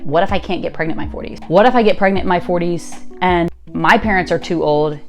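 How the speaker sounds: ordinary delivery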